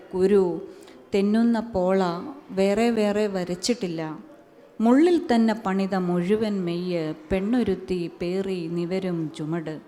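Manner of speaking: rhythmic recitation swelling in the middle; poetry recital. Acted. Poet reciting her poem on women, rising recital. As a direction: let the list build, then release.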